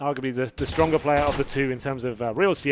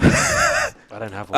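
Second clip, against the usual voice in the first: laughing; 13 semitones higher, 8 dB louder, much slower than usual. Real, not acted. Dark joke over laughter.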